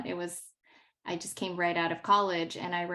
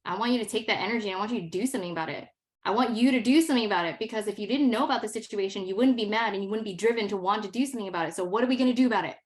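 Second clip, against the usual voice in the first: rapid and louder, urging; rally her to act, words tumbling out; teacher urges an angry student to turn it into action, fast and fired up; fast, fired-up pep talk; 5 dB louder, much faster than usual. Retold or imitated. Teacher rallies the student in retelling.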